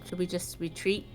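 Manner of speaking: ordinary talk, nothing marked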